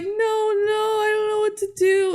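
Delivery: lightly hammed-up frantic sister; panicked sister. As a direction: squeak it out in mock panic. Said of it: "And Alex is like".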